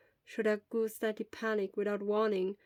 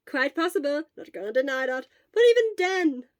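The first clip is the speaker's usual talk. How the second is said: cheerful reply; 5 semitones higher, 9 dB louder than usual. Acted. Hisashi bright and loud.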